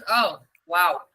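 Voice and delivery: flat low bored wow; unimpressed. Shown as a demonstration. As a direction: let the wow fall, clearly bored. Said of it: "or I can say".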